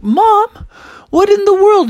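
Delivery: put-on loud, high indignant kid; shocked protest. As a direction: cry out in high-pitched disbelief. Froggy protests to mom.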